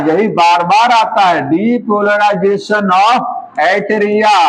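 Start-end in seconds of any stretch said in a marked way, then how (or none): 1.5-4.4 spelled out very slowly for memorising; slow dictation. Shown as a demonstration. Teacher dictating a key term to students, very slow. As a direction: drag each syllable so students can learn it by heart. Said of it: "यही रट लीजिएगा".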